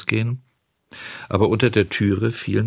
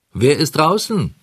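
high-pitched call through a door; Röhrle; 7 semitones higher, 4 dB louder than usual. A narrator's reading. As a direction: call out the question in a raised voice. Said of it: "fragte Röhrle". (hoch gerufene Frage)

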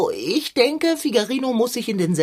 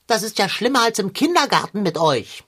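normal talking voice

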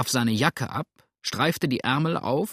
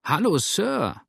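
surprised call; 7 semitones higher than usual. A narrator's reading. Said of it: "rief er erstaunt aus".

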